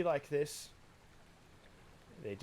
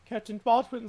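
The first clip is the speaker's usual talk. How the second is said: louder, higher urgent hail; guard hailing Captain Baldwin; urgent call; 8 semitones higher, 9 dB louder than usual. Acted. Guard calls to his captain.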